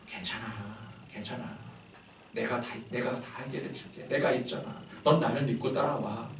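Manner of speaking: soft, near-whispered comfort; tender reassurance. Retold or imitated. The Lord comforting an exhausted believer, tender and hushed. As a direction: soothe a tired child. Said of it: "주님께서 그냥".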